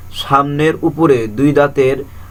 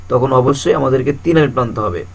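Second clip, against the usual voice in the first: ordinary talk, nothing marked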